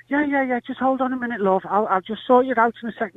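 high, lightly acted brush-off; distracted mother. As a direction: imitate a mum fobbing off her child. Distracted mother putting off daughter.

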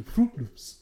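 Fruit Loops in a West African accent. Shown as a demonstration. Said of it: "like a African do".

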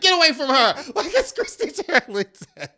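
hammed-up high shout breaking into laughter; mock yell. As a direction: bark at strangers, laughing at yourself. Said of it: "And I would be like".

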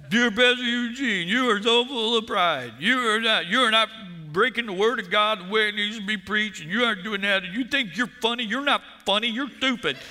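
high-pitched taunting read-out; hammed-up accusing voice; mock scolding letter. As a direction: read hate mail in a mocking scold. Acting out the critical letter.

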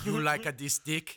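high, loud put-on African accent; mock African accent. Friend joking about African porn, mock African accent.

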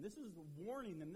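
ordinary talk

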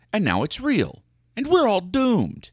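mock doom. Mock alarm about spiders.

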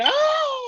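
high-pitched mock moan. Explaining dislike of constant hollering, high mock moan. Mimics fake loud moaning.

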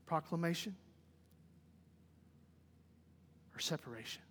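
low, soft, with long pauses; solemn preaching. Real, not acted. Preacher asking congregation where they stand, soft and solemn. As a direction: bring it down to a quiet, searching appeal. Preacher drops to quiet reflection.

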